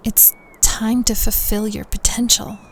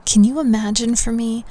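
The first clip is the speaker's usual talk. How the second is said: normal talking voice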